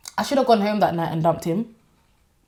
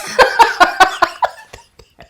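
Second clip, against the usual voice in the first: long high laugh, much louder; real laugh; 13 semitones higher, 8 dB louder than usual. Real, not acted. Laughing off her embarrassment about the breakup. Laughs after saying she was disgraced.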